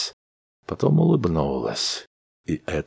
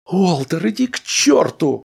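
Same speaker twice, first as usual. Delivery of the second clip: higher, loud angry outburst; shouted; 14 semitones higher, 5 dB louder than usual. A narrator's reading. Wife shouts at her jealous husband. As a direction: snap at him furiously. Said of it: "вскричала жена".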